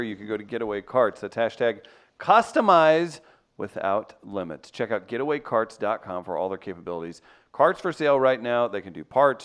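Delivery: ad read. Acted. Host reading a golf cart sponsor ad to listeners, upbeat. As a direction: sell it like a radio spot.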